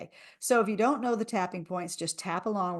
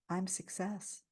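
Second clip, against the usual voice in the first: softer, gentle affirmation; 6 dB quieter, slower than usual. Shown as a demonstration. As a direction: say it quietly to yourself. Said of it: "repeat after me and listen".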